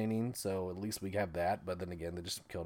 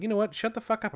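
annoyed snap; 11 semitones higher than usual. Retold or imitated. Robin snaps at Jake.

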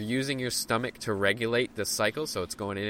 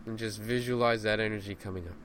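raised urgent cues, fading to soft release; guided breathwork cues; 5 dB quieter than usual. Acted. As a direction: push through the hold, then let go softly. Cues hold, root lock, release.

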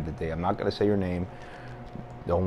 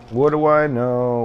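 mock exasperation; 5 semitones higher, 7 dB louder, slower than usual. Self-mocking repeated rhetorical question.

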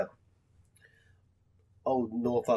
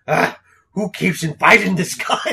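louder, exasperated gripe; Punisher complaining; 5 semitones higher, 10 dB louder than usual. Retold or imitated. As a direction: groan about the unwanted guest. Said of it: "the Punisher just goes".

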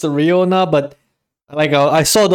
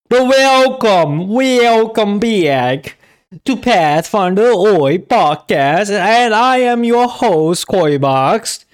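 announcer; 4 semitones higher, slower than usual. Acted. Podcast host intro.

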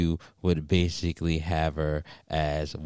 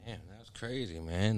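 a bit tipsy, loose and mumbly; 9 dB quieter than usual. Real, not acted. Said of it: "a little tipsy".

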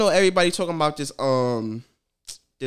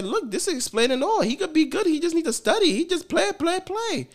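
much higher, sing-song teacher voice; teacher impression; 11 semitones higher than usual. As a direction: play up the teacher's lament over the test. Teacher showing the test.

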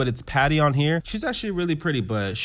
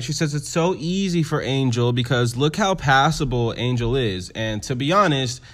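usual speaking voice

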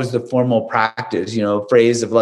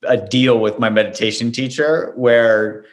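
ordinary delivery

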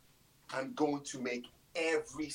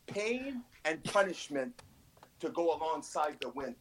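normal talking voice

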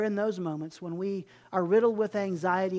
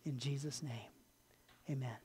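soft, hushed prayer voice sinking to a whisper; closing prayer; 3 semitones lower, 4 dB quieter than usual. Acted. Pastor prays over the congregation, soft and hushed. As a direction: pray quietly and intimately, trailing off.

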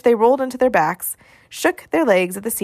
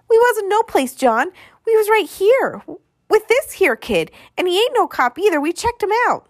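put-on high-pitched kid voice, loud and protesting; childish excuse-making, pitched way up; pleading little urchin; 12 semitones higher, 4 dB louder than usual. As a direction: plead your case like a small boy. Scared urchins answer angry brother.

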